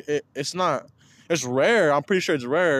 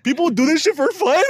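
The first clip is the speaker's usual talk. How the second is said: higher, incredulous question; retold amazement; 7 semitones higher than usual. Telling friends about his discovery, retold amazement. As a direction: relive the baffled discovery for laughs.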